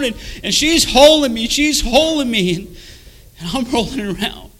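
loud, high-pitched testimony at full force; impassioned preaching. Real, not acted. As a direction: preach the torment with mounting urgency.

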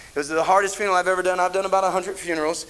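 normal talking voice

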